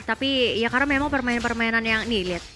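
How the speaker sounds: lively play-by-play commentary; esports caster. Acted. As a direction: narrate the fight like a caster. Casts the match play-by-play. (komentator esports)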